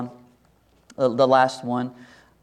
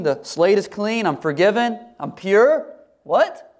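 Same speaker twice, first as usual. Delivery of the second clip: higher-pitched, disbelieving plea to God; incredulous doubter; 6 semitones higher than usual. Acted. Doubter asking the Lord how he could be forgiven, incredulous. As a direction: question it as if it can't be true.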